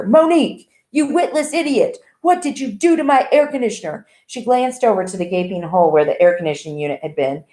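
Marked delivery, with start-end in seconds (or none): 0.0-4.0 high, furious shouted accusation; raging anger. A narrator's reading. Jordan raging at Monique about his missing air conditioner. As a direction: tear into her in a rage. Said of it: "his raging anger".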